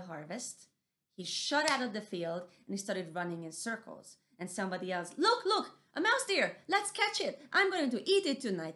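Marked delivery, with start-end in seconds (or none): 5.2-8.7 high, loud excited shout; greedy harvester. Acted. Harvester shouting at the sight of mouse deer. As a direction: call out to the others, eager to grab it.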